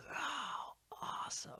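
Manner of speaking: awed whisper, imitating a starstruck interviewer; hushed, breath-only mimicry of amazement; Chris Farley. Imitating an interviewer thrilled by a star's answer, in an awed whisper. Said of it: "when Chris Farley did that interview".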